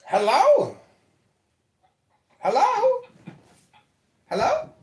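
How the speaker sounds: usual speaking voice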